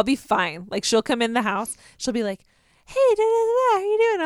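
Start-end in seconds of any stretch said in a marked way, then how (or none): 2.8-4.2 much higher bright mom greeting; sing-song mom voice; cheery mom voice. Retold or imitated.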